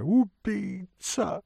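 high, drawn-out cry of alarm; lady screaming. A narrator's reading. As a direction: wail the accusation high and long. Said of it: "закричала дама". (высокий протяжный крик дамы)

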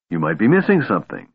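ordinary delivery, nothing marked

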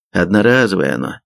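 plain reading voice

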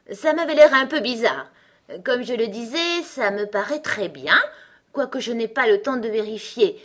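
high, loud, self-satisfied character voice; cheerful, boastful Gros Coco. Acted. Gros Coco proves his point to Alice, gaily boastful. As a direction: crow triumphantly over the proof.

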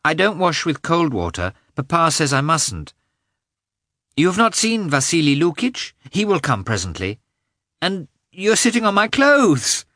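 put-on high little boy's voice; child prattling to his mother; chattering young boy. A narrator's reading. Boy chatters as mother dresses him.

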